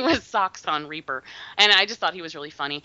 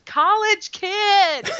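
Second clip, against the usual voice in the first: high, loud, long-drawn holler; imitated calling out; 13 semitones higher, 5 dB louder, much slower than usual. Guest imitating the film hero running through woods, drawn-out high holler. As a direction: stretch it out like calling across woods.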